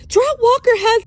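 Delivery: very high, loud cry, cut off; urgent cry. Acted. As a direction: blurt it out in alarm. Panicked woman cut off.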